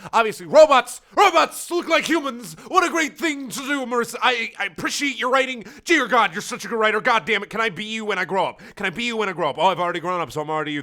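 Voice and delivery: loud high mocking praise; mock the writer with fake awe; sarcastic rant. Real, not acted. Ranting at fanfic author, loud sarcastic high praise. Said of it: "sarcastic rant at the author".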